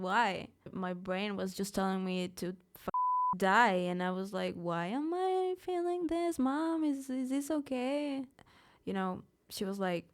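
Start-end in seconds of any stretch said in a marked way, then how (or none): 4.6-8.2 put-on high, slow questioning of a child; small high voice, drawn out; child's plea. Troubled child asking mom for reassurance, high slow plea.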